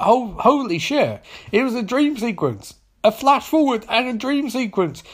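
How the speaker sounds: high-pitched, incredulous plot-twist reveal; mock amazement. Recapper revealing it was a dream, mock-amazed. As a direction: exclaim it in exaggerated disbelief.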